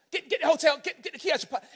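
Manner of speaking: high, loud hurried order to his son; urgent order. Retold or imitated. Father urging son at airport scanner, loud and urgent. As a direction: bark it at the boy, urgent.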